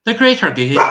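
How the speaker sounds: high, loud attempt at Gloucestershire; Gloucestershire accent. Shown as a demonstration. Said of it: "who can do the best Gloucestershire accent".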